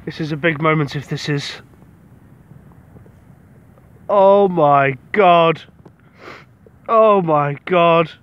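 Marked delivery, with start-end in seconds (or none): 4.1-8.1 slow, loud, drawn-out awe; overwhelmed with excitement. Real, not acted. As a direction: stretch each word out, stunned by the find. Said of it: "oh, I'm too excited".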